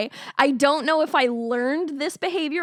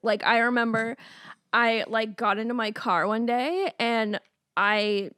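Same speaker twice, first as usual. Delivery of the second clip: ordinary talk, nothing marked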